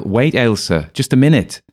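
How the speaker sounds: high, loud insistent shout. A narrator's reading. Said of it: "Louder, I yell".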